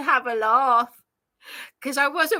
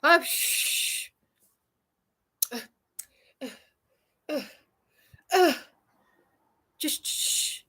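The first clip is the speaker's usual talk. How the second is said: acted-out shushing with small grunts; stiff-upper-lip hushing; 3 semitones higher than usual. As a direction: hush it up in little bursts. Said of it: "the British stiff upper lip".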